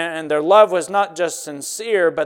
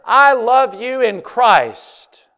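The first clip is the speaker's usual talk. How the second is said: tender apostle's words; 6 semitones higher, 7 dB louder, slower than usual. Retold or imitated. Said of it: "John is saying".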